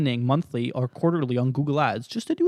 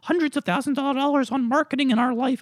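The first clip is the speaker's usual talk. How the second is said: much higher, whiny parent voice; mock parents; 12 semitones higher, 3 dB louder than usual. Imitating parents fretting about marketing money, whiny. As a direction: mimic the parents complaining.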